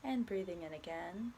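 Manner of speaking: calm, unhurried body scan guidance with long pauses; guided meditation. Acted. Guiding a listener through a body scan, soft meditation voice. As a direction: ease the listener gently from one body part to the next.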